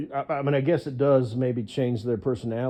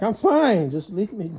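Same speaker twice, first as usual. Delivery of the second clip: annoyed wife; 8 semitones higher, 4 dB louder than usual. Pretend. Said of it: "She's like".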